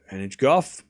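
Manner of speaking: brisk running commentary, rising at each name; live play-by-play. Acted. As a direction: call the rally as it happens.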